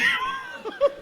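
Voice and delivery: a very high, shouted burst of real laughter. Real, not acted. Preacher roaring with laughter as his pastor sinks in the ice, high shouted laugh. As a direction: howl with laughter.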